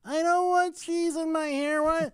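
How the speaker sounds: put-on high whining little kid; whiny child voice. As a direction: wail like an upset child. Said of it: "she's like".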